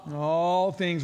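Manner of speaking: "All" drawn out and hit hard; stress on ALL. Real, not acted. Preacher answers what all means, "All" heavily stressed. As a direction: lean long and loud on "All".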